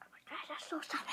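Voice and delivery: strained whisper reacting to sourness. Real, not acted. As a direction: whisper it, overwhelmed by sour. Sour candy reaction.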